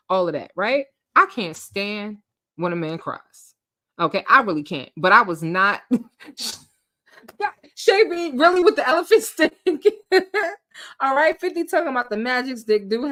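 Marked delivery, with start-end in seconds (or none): none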